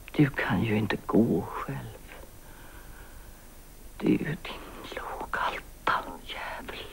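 slow, hushed father's insult; venomous whisper. Retold or imitated. Daughter quoting her father's cruel insult, whispered. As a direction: spit it out quietly. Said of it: "sa han".

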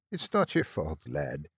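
softening Irish lilt, falling away; fading Irish accent. A narrator's reading. Nurse forgives the apologetic lad, Irish accent fading. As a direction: let the Irish fright ebb out. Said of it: "her accent fading as the fright faded".

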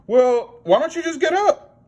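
high, puzzled puppet pony. Acted. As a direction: ask like a silly pony puppet. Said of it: "Pablo the pony's like".

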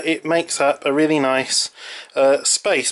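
normal talking voice

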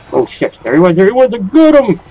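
high, loud excited yell; excited shout. Real, not acted. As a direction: burst out when you spot him. Suddenly spots the ghost.

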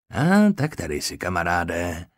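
mildly satisfied call; a touch of triumph as he finds it; pleased exclamation. A narrator's reading. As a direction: surgeon pleased to find the arrowhead, mild exclamation. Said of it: "zvolal spokojeně".

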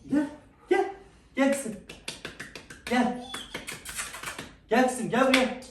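high, gentle coaxing to a frightened pet; coaxing pet call. Real, not acted. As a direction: sweet-talk the little one closer. Coaxing scared pet to come.

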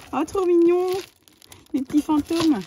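high, loud cooing over cute things; delighted coo. Real, not acted. Vlogger finding little ghost treats, high delighted coo. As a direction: gush like it's the cutest thing ever.

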